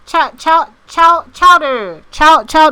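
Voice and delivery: loud, high, stuttering tries at "chowder"; parroting "chowder" loudly, getting stuck on the first syllable; chowder pronunciation practice. Shown as a demonstration. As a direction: drill "chow-" over and over, then "chowder" with a hard r.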